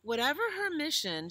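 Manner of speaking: usual speaking voice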